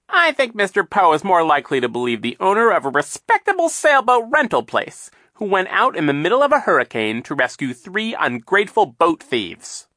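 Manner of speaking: high, loud, mocking villain voice; sly villain Captain Sham. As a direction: sneer it out like a scheming captain.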